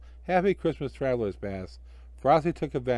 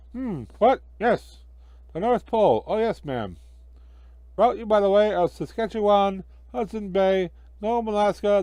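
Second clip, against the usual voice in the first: higher, louder fussy clerk; fussy ticket clerk; 7 semitones higher, 5 dB louder than usual. Acted. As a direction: rattle off the route like a busy clerk.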